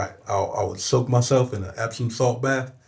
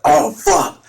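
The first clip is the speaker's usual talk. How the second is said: high, loud strained cry of pain; a hammed-up high pained yelp; strained croak; 8 dB louder than usual. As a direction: force it out through sore vocal cords. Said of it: "'cause my vocal cords were sore as fuck".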